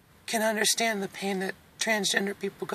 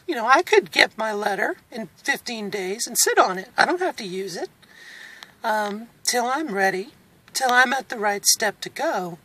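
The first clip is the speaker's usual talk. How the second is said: ordinary talk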